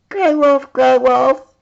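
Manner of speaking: high, loud calling-out princess voice; bossy little princess summoning the wolf. Acted. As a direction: call into the woods, high and loud.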